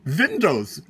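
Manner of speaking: "Windows" imitated with a V for the W; drawn-out "Windows", mimicking the V-like first sound; imitated V pronunciation. Caller quoting the tech's slip back at him, "Windows" said with a V. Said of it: "You pronounce the W with a V".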